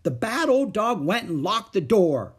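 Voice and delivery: put-on high, loud child blaming the dog; angry child's whine. A narrator's reading. Little girl blaming the dog to her mother, angry. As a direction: complain it loudly, near tears. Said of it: "shouted Ramona angrily".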